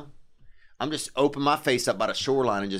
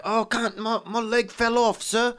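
high pleading Cockney beggar voice; Cockney street urchin; 9 semitones higher than usual. Retold or imitated. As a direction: whine like a poor London street kid. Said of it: "if you're like that".

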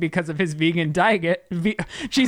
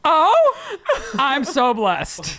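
sarcastic; 8 semitones higher, 3 dB louder, much slower than usual. Acted. Mock gratitude over leak.

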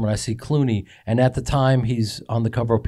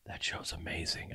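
low hushed confiding voice; imitating Clooney's hushed praise; 7 semitones lower, 18 dB quieter than usual. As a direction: lean in and say it under your breath.

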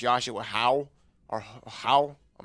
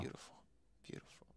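breathy unvoiced whisper of beautiful; 29 dB quieter, faster than usual. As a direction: breathe it out in awe. Admiring background, voice drops.

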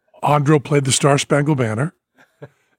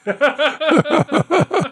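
high bursting laugh; hearty laugh; 13 semitones higher than usual. Real, not acted. Telling a stage story, bursting into a high laugh. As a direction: let a big laugh break out.

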